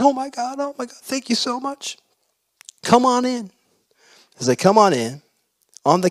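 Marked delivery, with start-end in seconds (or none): 0.0-2.0 high, thin, mock-tearful voice; tearful thanks. Marcia receives the envelope of money, imitated tearful thanks. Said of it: "bursts into tears". 2.8-3.5 high-pitched, imitated teary invitation; tearful welcome. Marcia asks the giver inside after crying, tearful welcome. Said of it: "bursts into tears".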